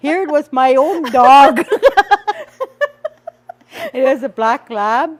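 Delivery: laughing. Real, not acted. Realizes it was her dog.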